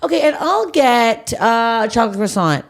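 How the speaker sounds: breezy and unbothered, like nothing happened; casual line cutter. Retold or imitated. Imitating the woman cutting the coffee line, lightly voiced. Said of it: "She goes".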